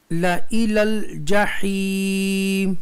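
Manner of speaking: ला इलल जहीम slow, long chanted ee at the end; recitation demo. Shown as a demonstration. Teacher shows students the reading, drawn-out ला इलल जहीम. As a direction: sustain the ending of ला इलल जहीम on one note. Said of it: "ज़ैद अलिफ को नहीं पढ़ेंगे".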